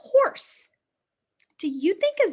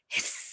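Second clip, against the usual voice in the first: snake hiss; 6 dB quieter than usual. Shown as a demonstration. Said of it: "Can you do it with me?".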